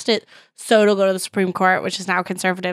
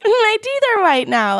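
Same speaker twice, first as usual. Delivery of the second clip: high chirpy ad voice; 12 semitones higher than usual. Retold or imitated. Commercial woman quoted.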